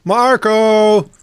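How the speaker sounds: high, drawn-out calling yell. Acted. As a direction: call out the name long and high, playfully. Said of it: "tired of just yelling out".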